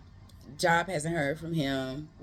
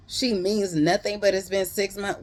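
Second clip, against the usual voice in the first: higher, louder mock-outraged echo; acted indignant repeat of a lame excuse; incredulous retort; 5 semitones higher, 4 dB louder than usual. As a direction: throw his excuse back at him, loud and high. Acted confrontation, throwing his excuse back.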